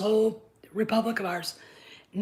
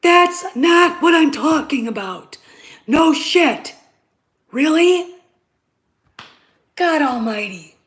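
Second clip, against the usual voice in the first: angry shout; 6 semitones higher, 8 dB louder, slower than usual. Real, not acted. Shouting back at his listeners.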